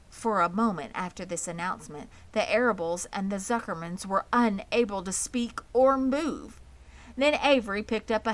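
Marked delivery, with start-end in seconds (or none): none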